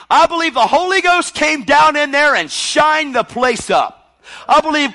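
high, loud pulpit declaration; fervent, shouted sermon; full-force preaching. Real, not acted. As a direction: preach it at the top of your voice.

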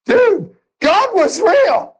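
high, slow, drawn-out wonder; amazed and overjoyed; awed exclamation. Real, not acted. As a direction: let the wonder spill out.

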